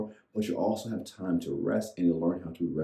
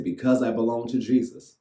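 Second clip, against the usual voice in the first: call to repeat; 5 semitones higher, 5 dB louder than usual. Shown as a demonstration. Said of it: "say it out loud".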